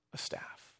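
breathy whispered answer; whispered reply. Retold or imitated. Moses answering God in a whisper. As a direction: whisper it meekly.

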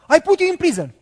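high, loud furious yell; boss screaming. Retold or imitated. Boss threatening the worker, screaming in fury. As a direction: bellow the threat at him. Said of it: "And he started to scream".

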